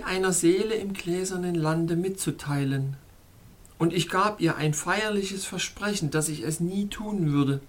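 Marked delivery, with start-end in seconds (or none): none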